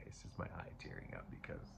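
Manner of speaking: teary whisper. Real, not acted. Reader admitting tears to viewers, whispered. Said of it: "See, I'm not crying".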